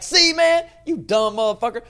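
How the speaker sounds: triumphant high-pitched yell, imitating himself; aggressive. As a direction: crow it loudly in their face. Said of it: "'cause I'm so aggressive".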